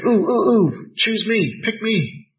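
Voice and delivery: high, eager pick-me voice; hammed-up high-pitched plea; mock eager pleading. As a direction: beg to be chosen like an overeager pupil. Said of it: "Did she pray".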